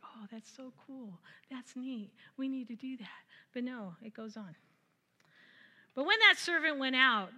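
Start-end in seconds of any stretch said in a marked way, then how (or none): 0.0-3.1 hushed mock delight.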